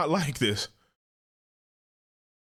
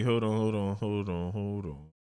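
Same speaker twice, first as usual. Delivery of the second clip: 1 fading mutter; 5 semitones lower, 5 dB quieter than usual. Real, not acted. Fading while searching.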